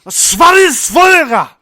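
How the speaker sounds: full-force high German shout; hammed-up angry German accent, drawn out; aggressive German. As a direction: bellow it like a very upset German. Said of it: "sounds like they're very upset".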